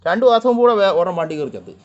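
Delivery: loud, high burst of talk. Real, not acted. Talking loudly over the cats.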